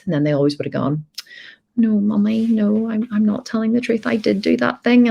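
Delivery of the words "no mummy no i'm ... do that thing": put-on child confessing.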